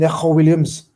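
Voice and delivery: Neco Williams in a Scouse accent, k as kh; attempted Scouse Neco Williams. Shown as a demonstration. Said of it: "said in a proper Scouse accent there".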